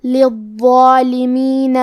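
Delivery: للظالمین very slow, ضو doubled and heavy, long alif; heavy recitation. Shown as a demonstration. Teacher demonstrating للظالمین, slow heavy recitation. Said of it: "بھاری آواز میں پڑھیں گے".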